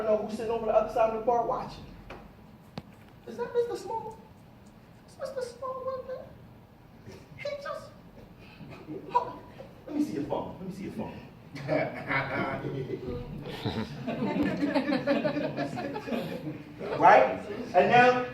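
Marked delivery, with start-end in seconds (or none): 3.3-11.1 much higher, softer squealing voice; imitating an excited fan. Speaker imitates a stranger spotting Mister Small at the bar, high and gushing. 11.5-16.9 long breathy laugh; real laugh. Real, not acted. Speaker laughs at the fan imitation, long and breathy.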